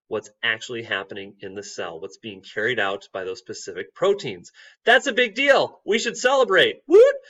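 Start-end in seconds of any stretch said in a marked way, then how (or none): 4.8-7.2 high, loud mock celebration; excited cheer. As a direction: cheer it like a party. Celebrating the DNA link.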